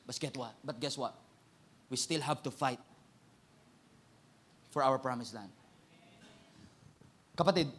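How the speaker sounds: soft, low, intimate preaching; hushed closing appeal. Acted. Preacher softly pressing the congregation toward commitment. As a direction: draw them in quietly.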